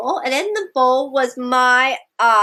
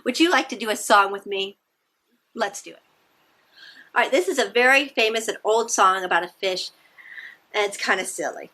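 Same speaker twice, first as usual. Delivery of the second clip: plain reading voice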